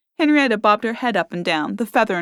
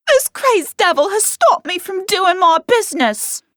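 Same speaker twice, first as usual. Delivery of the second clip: much higher, aggrieved whine; whining complaint; 12 semitones higher, 4 dB louder than usual. A narrator's reading. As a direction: whine to the man. Barmaid complaining to the man.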